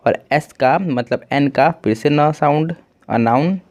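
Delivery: usual speaking voice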